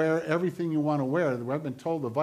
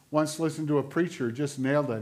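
ordinary delivery

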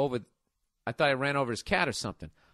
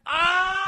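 a sudden high yell of exasperation; frustrated shout; 7 dB louder than usual. Real, not acted. Host reacting to the neighbor's smirk, frustrated shout. Exploding at the letter writer's story.

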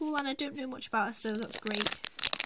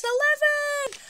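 much higher, louder excited reveal; excited announcement; 14 semitones higher, 6 dB louder, slower than usual. Real, not acted. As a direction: announce it with a big, high flourish.

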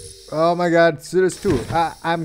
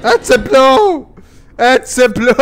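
overacted death scene; 5 semitones higher, 6 dB louder than usual. Killed in the game.

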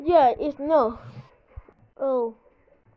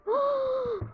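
high startled gasp; 11 semitones higher than usual. Real, not acted. Startled by game character.

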